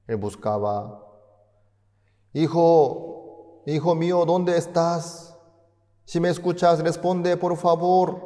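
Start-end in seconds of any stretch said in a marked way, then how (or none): 2.3-8.2 anxious father calling. Acted. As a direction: cry out for a lost son over the radio. Father calls son on radio.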